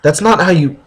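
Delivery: ordinary talk, nothing marked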